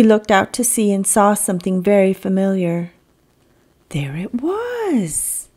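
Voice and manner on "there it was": slow soft wonder; a hint of hushed wonder; hushed reveal. A narrator's reading. Narrator reveals discovery.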